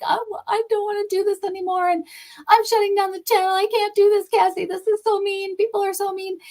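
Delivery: high-pitched, imitating her own tearful wail; retold upset crying. Imitating her tearful self telling Cassie she's quitting, high upset wail. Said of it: "I'm upset and I'm crying".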